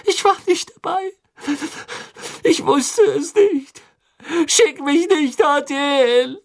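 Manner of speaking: hohe, flehende Jungenstimme, den Tränen nah; verzweifelter Junge. A narrator's reading. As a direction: fleh die Mutter in Panik an. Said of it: "Wieder begann der Junge zu schreien". (hoch und verzweifelt bettelnd)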